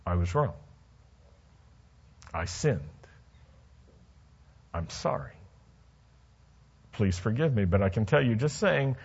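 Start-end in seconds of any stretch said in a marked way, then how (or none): 0.1-7.6 sincere apology. Shown as a demonstration. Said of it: "easier for us just to say".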